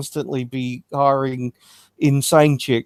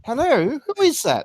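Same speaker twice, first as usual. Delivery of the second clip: phone voice; 13 semitones higher than usual. Pretend. Mock phone call voice.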